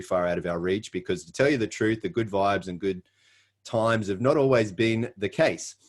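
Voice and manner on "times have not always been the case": usual speaking voice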